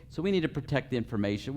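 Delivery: normal talking voice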